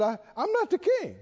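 mock tearful protest. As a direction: lightly imitate a tearful underling. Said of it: "he started crying and said".

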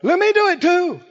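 bold, high-pitched eager plea; Peter. Acted. Bold disciple asking Jesus to let him join, eager and high. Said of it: "And Peter is bold".

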